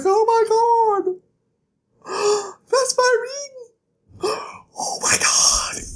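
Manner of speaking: very high, slow, tearful sobbing gasps; melodramatic sobbing. Retold or imitated. Vlogger mimicking Dinah getting her ring back, sobbing and gasping. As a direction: overact a weepy shocked Dinah, high. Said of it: "And she's like".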